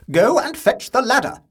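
high, loud command; stern order. A narrator's reading. Aunt in the tank, ordering the boy sharply. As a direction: bark the order at him.

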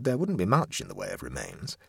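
usual speaking voice

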